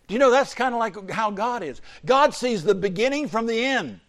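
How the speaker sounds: preaching. Acted. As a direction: drive the point home to the congregation.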